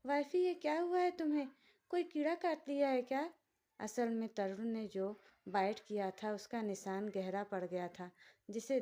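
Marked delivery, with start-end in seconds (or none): 0.1-3.3 put-on higher, quick, panicky childlike voice; childlike alarm. A narrator's reading. Lakshya spots the mark on his wife's neck, alarmed and childlike. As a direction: blurt it out in a fright. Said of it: "घबरा कर बोला".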